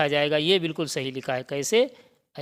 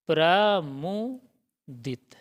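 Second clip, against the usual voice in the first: प्रमुदित stretched out very slowly; teacher modelling प्रमुदित slowly; slow model word; much slower than usual. Shown as a demonstration. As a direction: draw out प्रमुदित for the class. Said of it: "सही होता है".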